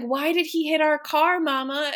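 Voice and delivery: plaintive retold child's question; mock tearful child. Crying daughter questions her mama, mock tearful. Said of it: "then she starts crying even harder".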